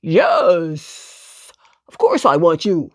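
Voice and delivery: lightly voiced dog soldier. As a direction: answer firmly as the big dog. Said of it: "replied the dog".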